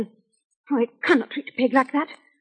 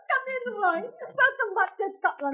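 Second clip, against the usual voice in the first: tearful joy; 8 semitones higher, 6 dB quieter than usual. Acted.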